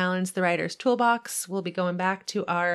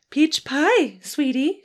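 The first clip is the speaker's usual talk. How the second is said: sweet mom; 8 semitones higher, 5 dB louder, slower than usual. Acted. Mom teasing.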